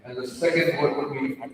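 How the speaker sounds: ordinary talk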